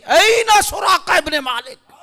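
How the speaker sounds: high, drawn-out summoning shout; loud call. Acted. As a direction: call out across a crowd for someone. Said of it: "حضرت عمر نے زور سے آواز دی". (زور دار، کھینچی ہوئی پکار)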